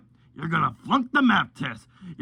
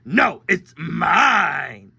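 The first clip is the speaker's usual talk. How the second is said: stubborn drawn-out no; much slower than usual. Acted. Drawn-out childish argument.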